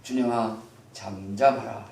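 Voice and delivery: low, soft mock-solemn parent; hushed scriptural dignity, played for contrast; lofty, refined. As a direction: address a child with stately calm. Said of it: "이렇게 하지는 않습니다".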